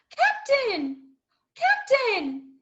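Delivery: loud alarmed cry of a name; shouting for the captain who won't answer; frightened shout. Acted. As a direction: call out in panic to the dead man. Jim finds the captain dead.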